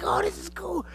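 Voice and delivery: very high excited oh this is cool; a kid seeing wonders; thrilled high voice. Retold or imitated. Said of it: "And you're like".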